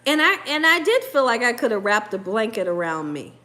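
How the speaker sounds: usual speaking voice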